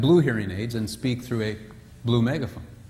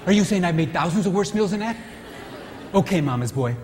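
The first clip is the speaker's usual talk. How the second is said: offended wife; 3 semitones higher than usual. Retold or imitated. Wife snapping at her husband over the meal, indignant. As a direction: take offence and hit back hard.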